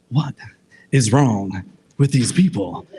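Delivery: halting, emphatic captain's delivery; Kirk. Acted. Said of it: "Kirk is like".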